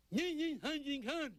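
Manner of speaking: sing-song the secret phrase with a laugh; squeaky coded greeting. Acted. He tries the code phrase.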